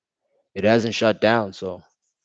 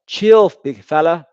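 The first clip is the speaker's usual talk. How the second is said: calling out; 4 semitones higher, 10 dB louder than usual. Real, not acted. Yelling at player on screen.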